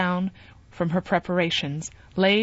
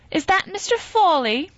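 much higher woman's voice calling up; Arabella calling up; 12 semitones higher, 4 dB louder than usual. A narrator's reading. Arabella calling up from the street to Jude, much higher. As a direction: call up to the window from the street. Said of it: "a voice which was unmistakably Arabella's".

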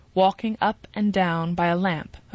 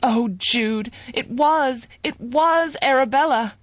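higher, louder anguished cry; 6 semitones higher, 4 dB louder, slower than usual. A narrator's reading. Sue crying out to Jude in dismay, higher and louder. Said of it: "cried Sue".

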